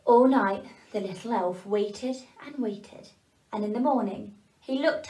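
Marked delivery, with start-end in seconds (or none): none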